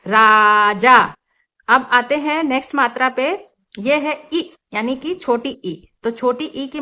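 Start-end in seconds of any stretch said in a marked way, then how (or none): 0.1-1.0 राजा said very slowly and louder, both aa sounds stretched; slow loud teacher's राजा with long open aa; drawn-out vowels. Shown as a demonstration. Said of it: "sound थोड़ा खींच कर लिया जाएगा".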